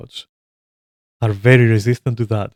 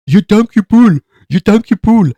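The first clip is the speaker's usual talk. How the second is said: high, loud squawking imitation of a nagging player; mocking parrot voice; 10 semitones higher, 7 dB louder than usual. Mocking a whiny teammate demanding a pull, high and loud squawking. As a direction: parrot it back like an annoying teammate. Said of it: "you fucking mutated parrot".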